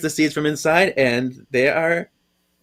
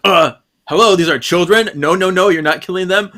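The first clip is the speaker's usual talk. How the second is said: exasperated protest; 6 semitones higher, 7 dB louder, faster than usual. Retold or imitated. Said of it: "And then Daryl's like".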